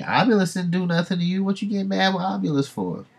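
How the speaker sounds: high, loud, offended sidekick protesting; comic squeaky creature. Acted. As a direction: squeal it like an indignant little pet. Dad's pet voiced in the argument.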